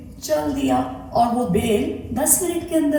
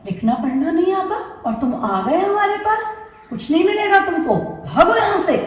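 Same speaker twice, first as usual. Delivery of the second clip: scolding guava; 8 semitones higher than usual. Acted.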